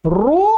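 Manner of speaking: रू as short r plus clear oo; wrong form रू. Shown as a demonstration. Teacher contrasting for students, wrong रू. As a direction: say रू with hardly any roll, straight into oo. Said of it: "difference समझिएगा".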